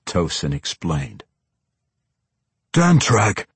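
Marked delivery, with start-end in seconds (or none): 2.7-3.5 higher-pitched exclamation; crying out. A narrator's reading. Weapons master names the sword's old owner, crying out. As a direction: cry the name DANTRAG out, moderately raised. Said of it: "Anzarel cried".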